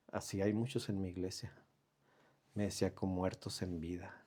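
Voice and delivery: low, hushed and solemn; quiet solemn voice. Retold or imitated. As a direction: speak it like a grave secret. God's voice retold.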